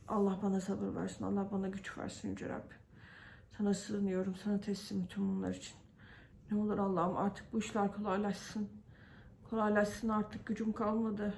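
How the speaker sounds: soft, hushed plea to God, worn out; quiet prayer. Real, not acted. Praying softly to God for patience, hushed and weary. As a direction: murmur a tired prayer under your breath.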